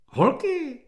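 put-on high-pitched local girl's squeal; very high excited call. A narrator's reading. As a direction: squeal it out to your girlfriends. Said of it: "vypískla jedna z místních krasovic".

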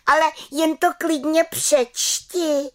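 high, bold challenge; defiant cry. Acted. Little witch challenges aunt Bimbula, high and defiant. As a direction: dare her to read it. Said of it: "vykřikla malá čarodějnice".

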